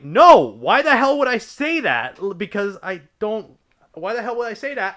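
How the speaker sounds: retold outraged no, pitched high; indignant denial. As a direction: defend yourself against a false accusation.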